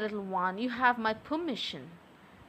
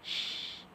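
shushing; 9 dB quieter than usual. Acted. Soothing him mid-tease.

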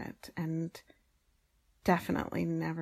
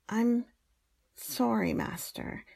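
ordinary delivery

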